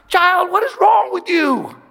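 put-on high, exasperated dad yelling at his kid. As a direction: throw up your hands at the child. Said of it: "and says".

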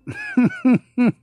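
high loud burst of laughter. Real, not acted. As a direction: crack up loudly. Host cracking up.

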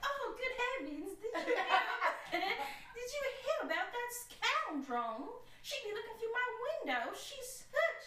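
high, scandalised Southern gossip; hammed-up Southern belle, giggly. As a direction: gasp and gossip like a Southern lady. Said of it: "those Southern belles".

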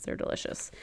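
breathy whisper aside. Real, not acted. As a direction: whisper it like a secret.

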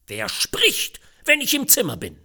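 high, loud pompous rebuke; growling father. A narrator's reading. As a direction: bark at the child to be silent. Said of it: "grollte der väterliche Fürst". (grollender Haustyrann, laut und hoch)

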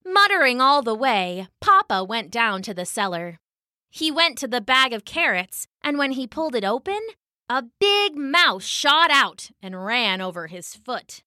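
ordinary delivery, nothing marked

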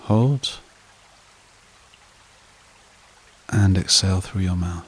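guided meditation. Acted. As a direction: lull them evenly, softening as each phrase settles. Leads a breathing meditation.

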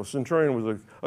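ordinary talk

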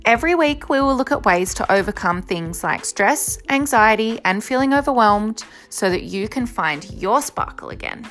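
bright, upbeat show opener; podcast intro. Acted. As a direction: welcome listeners like a host.

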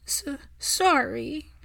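small halting voice, high and slow; a boy stuttering through tears, slow and high; upset little boy. Acted. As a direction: stammer it out like a tearful child. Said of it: "the upset boy".

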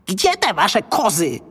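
high-pitched goblin question; small creature's high voice. A narrator's reading. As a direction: ask urgently as a little goblin. Goblin asks the boy.

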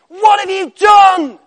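a furious bellow, hammed up for the sermon; angry shout. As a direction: roar it high and loud as an angry God. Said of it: "With an angry voice".

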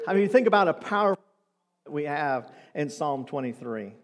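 ordinary delivery

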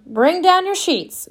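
high, slow call up the stairs; grandma hollering. A narrator's reading. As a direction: call it up the stairs to wake the house. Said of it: "Grandma hollered up the stairs".

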